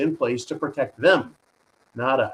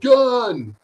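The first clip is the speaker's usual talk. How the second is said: a sharp, high, loud cry of alarm; mock yell; 9 semitones higher, 7 dB louder than usual. A single panicked word yelled at the rally, sharp shout. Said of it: "someone just yelled".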